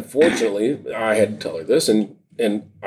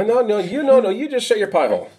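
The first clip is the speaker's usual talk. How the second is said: high, laughing shush; playful mock scolding; 10 semitones higher than usual. Real, not acted. Host playfully shushes his co-host, high and laughing. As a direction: jokingly tell him to shut it.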